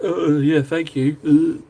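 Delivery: mock monotone, barely moving in pitch; deadpan, droning thank you. Imitating someone who can't take a compliment, in a monotone. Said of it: "He just turns into this monotone".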